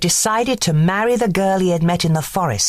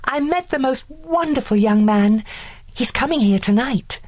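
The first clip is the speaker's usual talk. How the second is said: excited; 9 semitones higher than usual. A narrator's reading. Girl in love gushing.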